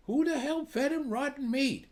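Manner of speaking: outraged. Acted. Boss outraged at caretaker's news.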